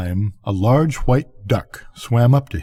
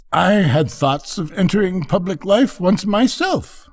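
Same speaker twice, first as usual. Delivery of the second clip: high-pitched duck lady voice; chatty duck; 9 semitones higher than usual. Acted. Duck remembering her public ambitions, placidly chatty. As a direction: reminisce in a much higher voice.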